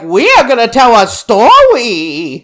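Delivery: loud, high mock baby-talk puppet; hammed-up babyish lisp, r's turned to w's. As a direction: imitate an annoying puppet belting out baby talk. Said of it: "like, that baby talk".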